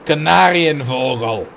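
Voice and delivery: loud, slow German word in stray Cockney Dutch; botched Cockney Dutch accent. Pretend. Gamer trying a long German word, accidental Cockney Dutch accent. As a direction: attempt German, land in Cockney Dutch, loudly. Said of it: "It was like Cockney Dutch or whatever".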